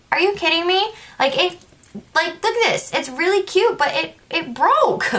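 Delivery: high-pitched indignant complaint; whiny disbelief. Retold or imitated. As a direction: plead your case in a high whine, dragging the last words. Indignant complaint over the broken ring.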